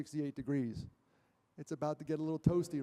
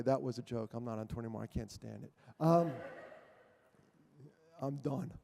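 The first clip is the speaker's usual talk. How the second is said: ordinary talk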